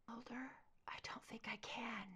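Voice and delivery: weak, dying whisper; barely audible, giving up; faint whisper. Acted. Dying partner whispers weakly.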